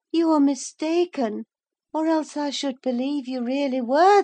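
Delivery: put-on high, loud feverish young mistress; insistent fevered heroine, pitched well up; delirious sick woman. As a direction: play her wandering in her wits, high-pitched.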